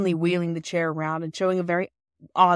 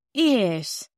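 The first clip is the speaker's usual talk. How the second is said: drawn-out thoughtful yes; reflective; 4 semitones higher, 5 dB louder than usual. A narrator's reading. Husband mulling over the gifts, reflective. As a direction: ponder it aloud. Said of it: "said Perks reflectively".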